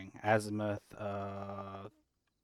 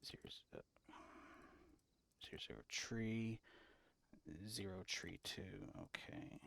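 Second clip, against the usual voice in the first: whispering; 3 semitones lower, 15 dB quieter, faster than usual. Real, not acted. Whispering to himself.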